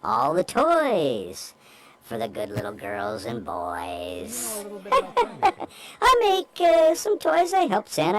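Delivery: high squeaky elf voice; christmas elf. Pretend. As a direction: play a cheerful little elf. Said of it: "Disguise your voice".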